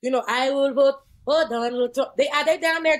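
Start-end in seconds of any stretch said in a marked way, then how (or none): 0.3-2.2 mock Cuban accent. Mimicking a conservative Cuban voter in Florida, mock Cuban accent.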